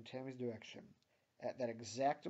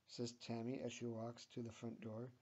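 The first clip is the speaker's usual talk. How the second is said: ordinary delivery, nothing marked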